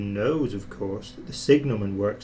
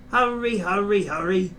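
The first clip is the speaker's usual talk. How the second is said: lightly voiced puffing engine, higher; puffed; 7 semitones higher than usual. A narrator's reading. James racing with the express, puffing. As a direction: puff it out in a rush. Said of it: "puffed James".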